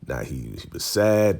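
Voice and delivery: ordinary talk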